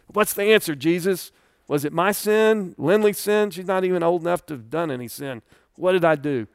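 father's anguished high-pitched plea for his daughter; desperate questioning. Retold or imitated. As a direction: beg Jesus for an answer. Crying out to Jesus, retold.

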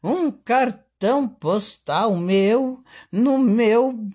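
over eager infomercial. Pretend. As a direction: sell it like a daytime TV host. Continues the TV sales pitch.

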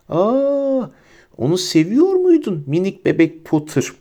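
put-on high, drawn-out cooing baby talk; mock someone as if cooing to a baby. A narrator's reading. Bellatrix taunts Harry over his cousin, mocking baby voice. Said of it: "Bebek sesiyle konuşmayı bırakmıştı şimdi".

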